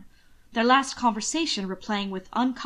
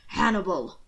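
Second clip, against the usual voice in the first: snapped rebuke; 2 semitones lower than usual. A narrator's reading. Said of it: "the Duke snapped".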